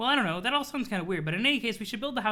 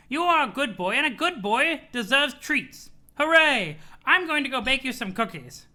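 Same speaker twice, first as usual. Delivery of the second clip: lightly voiced doting grandmother, higher; Nana voice; 5 semitones higher, 5 dB louder than usual. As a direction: praise the boy like a doting nana. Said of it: "the float hag".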